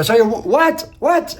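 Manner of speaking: retold yell, pitch leaping high on the last what; half-acted shout of confusion at the start lady; shouting at the start lady over the noise, playfully; mock screaming. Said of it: "I'm screaming at her".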